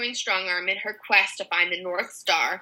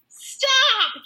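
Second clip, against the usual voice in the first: high, loud cry of Stop!; shouted; 4 dB louder than usual. A narrator's reading. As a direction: yell to stop her. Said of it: "George shouted".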